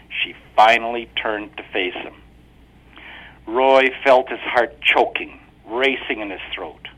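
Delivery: ordinary delivery, nothing marked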